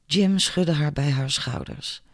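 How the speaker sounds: ordinary delivery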